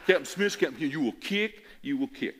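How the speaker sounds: put-on Southern referee accent. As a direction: announce it like a Mississippi official. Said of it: "real deep Southern accent".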